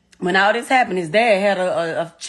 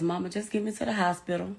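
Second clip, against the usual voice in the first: much softer retold plea; son's quoted plea; 3 semitones lower, 10 dB quieter, faster than usual. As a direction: quote the wounded son's words, hushed and understated. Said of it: "the only thing my son said was".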